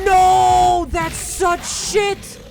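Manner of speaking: loud high yell, drawn out slowly; panicked yell. Real, not acted. Chased gamer hits a dead end, panicked loud yell. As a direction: yell out in frustration and fear.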